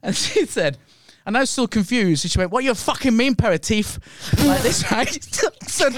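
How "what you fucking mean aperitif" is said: angry retold quote in a higher-pitched half-Chinese, half-Cockney accent; mock Chinese-Cockney accent, pitched up; imitated Chinese-Cockney accent. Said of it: "that's half Chinese and half Cockney".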